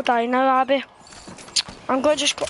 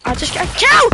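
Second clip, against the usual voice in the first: much higher and loud, triumphant; excited shout; 9 semitones higher, 7 dB louder than usual. Real, not acted. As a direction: crow about the kill. Celebrating a kill in game.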